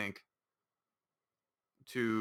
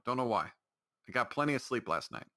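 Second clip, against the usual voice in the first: usual speaking voice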